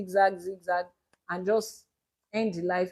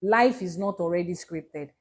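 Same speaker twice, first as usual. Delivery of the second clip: usual speaking voice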